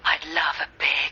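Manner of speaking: soft breathy whispered purr; breathy vintage purr. Acted. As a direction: breathe it out like a seductress. Sexy phone line hostess teasing.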